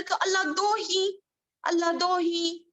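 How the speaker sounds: अल्लाह दो ही with the vowel drawn too long; wrong lengthening. Shown as a demonstration. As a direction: show how stretching changes the meaning. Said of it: "थोड़ा और खींच डाले".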